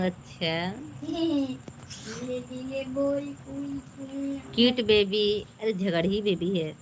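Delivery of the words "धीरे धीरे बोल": very slow, softer and higher. Shown as a demonstration. Mother showing how to speak slowly to viewers, very slow. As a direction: drag each word out gently. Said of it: "मैं धीरे धीरे बोल रहा हूँ".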